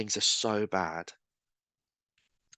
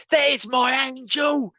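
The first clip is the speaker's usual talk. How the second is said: much higher, louder and slow; imitating the grateful woman; 7 dB louder, slower than usual. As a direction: call out her joyful greeting, high and drawn out. Woman greets him at baptism.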